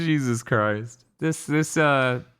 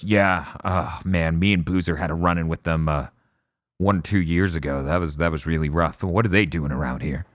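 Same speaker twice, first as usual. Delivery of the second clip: a hint of a low, gruff biker voice; lowered Deacon voice; 7 semitones lower, 3 dB quieter than usual. Retelling a game cutscene to friends, a lightly lowered Deacon. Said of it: "And Deacon goes".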